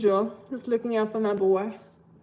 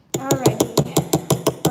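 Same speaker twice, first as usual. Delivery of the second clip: drawn-out alrighty; 2 semitones higher, 6 dB louder, much slower than usual. Real, not acted. Drawn-out announcement to camera.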